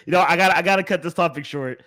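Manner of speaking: normal talking voice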